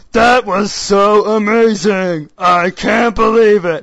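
hammed-up bad acting, each word dragged; mocking bad voice acting. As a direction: read it like a wooden dub actor, drawn out. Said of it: "it's like".

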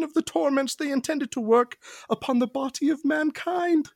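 milk the tragic confession; whiny archaic confession. Acted. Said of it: "that Mycroft was pretty whiny".

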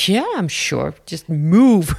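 retold impatient insistence. Woman desperate to get out, impatient mock insistence. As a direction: push past them with a punched final word. Snapping at girl blocking her exit.

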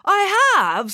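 very high, happy confirmation; delighted. A narrator's reading. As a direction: own up to it gleefully. Binky proudly confirms his love.

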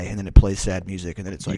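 normal talking voice

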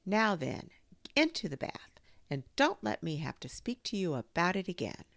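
high, emphatic motherly scolding; lilting stern warning; rather severe. A narrator's reading. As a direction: scold the child firmly but lightly. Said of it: "said Kanga rather severely".

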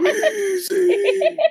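very high, strained wheeze; wheezing Pokemon voice. Pretend. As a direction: squeeze the word out like a wheeze. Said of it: "your coughing and wheezing impersonations".

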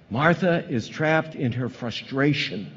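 ordinary delivery

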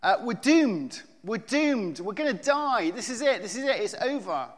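loud, high panicked cries; hammed-up shipwreck panic; panicked screaming. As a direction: yell it like you're sure you'll die. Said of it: "began screaming".

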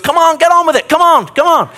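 impatient crowd. Retold or imitated. Crowd rushing the blind man to Jesus, loud and impatient. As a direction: hustle him along, pushy and shrill with impatience. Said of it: "he goes".

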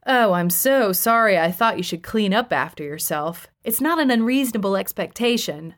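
plain reading voice

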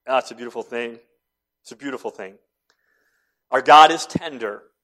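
ordinary talk

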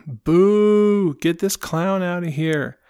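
high, loud mock boo from the crowd; heckler boo. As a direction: heckle the clown off the stage. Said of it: "somebody in the audience is like".

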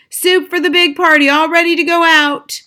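high, loud kitchen call; shouted. A narrator's reading. Cook announces soup for the big party, shouted. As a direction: holler it across a busy kitchen. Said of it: "he put the lid on the basin and shouted".

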